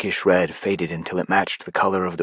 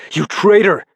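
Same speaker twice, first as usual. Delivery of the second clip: much higher and louder outrage; furious shout; 12 semitones higher, 8 dB louder than usual. Acted. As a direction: yell at the betrayer. Narrator confronts traitor.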